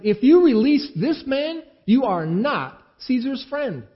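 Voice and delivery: loud, high, slow threatening shout; a mob shouting its threat, slow and high; crowd shouting. A narrator's reading. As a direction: press Pilate with a hammered shout.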